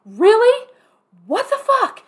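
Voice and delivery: stunned, shouted what the fuck; all caps outrage. Retold or imitated. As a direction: shout it high, in disbelief. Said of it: "all caps".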